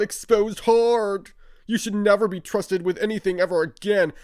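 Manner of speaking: high, loud sneering voice reading the tweet aloud; mocking read of a rival's tweet. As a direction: read his words back like a smug whiny brat.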